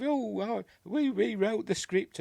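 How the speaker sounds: high, light impression of a London cor blimey accent; mock cor blimey accent. Vlogger imitating the actress's London cor blimey interview accent. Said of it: "with a kind of a core blimey".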